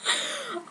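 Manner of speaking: quick high giggle; laughing fit. Real, not acted. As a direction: crack up. Breaks up laughing.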